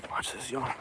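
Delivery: soft, furtive heads-up to viewers; hushed aside. Real, not acted. Showing viewers the neighbor's dogs, hushed. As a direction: drop to a near whisper.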